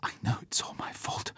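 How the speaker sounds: whispered, near sobbing; tearful whisper. A narrator's reading. Vesper confessing guilt to Bond, whispered through tears. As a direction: whisper it while dabbing your eyes. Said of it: "Suddenly her eyes filled with tears".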